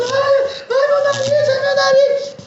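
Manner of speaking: high, loud cries of pain over her nose; screaming in pain. Acted. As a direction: wail like a woman just bitten on the face. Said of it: "a mulher lá gritando".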